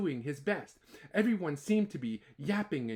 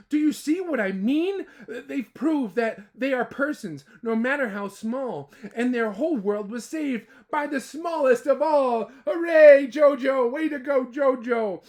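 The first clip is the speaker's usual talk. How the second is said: cheering; 6 semitones higher, 8 dB louder than usual. Acted. Elephant cheers the saved town.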